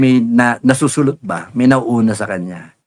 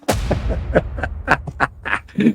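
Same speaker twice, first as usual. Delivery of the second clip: breathy laugh; 3 semitones higher, 7 dB quieter than usual. Real, not acted. Laugh alone on line.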